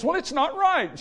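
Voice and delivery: put-on high-pitched comic old man protesting; mock indignant high voice; arguing old man. Imitating arguing nursing-home man.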